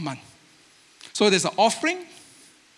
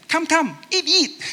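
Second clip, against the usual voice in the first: high, quick doubled words like a pushy host; 9 semitones higher than usual. Retold or imitated. Host urging guest to eat, high insistent doubling. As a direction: press food on a guest, high and eager.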